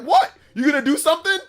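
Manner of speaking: loud, high dare between laughs; challenging shout. Retold or imitated. Squaring up to the manager in a booth, loud challenge. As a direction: dare him to swing, half laughing.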